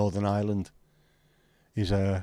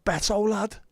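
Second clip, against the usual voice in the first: higher put-on Scouse voice on "Beto lad"; thick Scouse "Beto lad"; Scouse accent; 10 semitones higher than usual. Shown as a demonstration. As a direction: say "Beto lad" like a proud Liverpool lad. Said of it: "it sounds fairly Scouse".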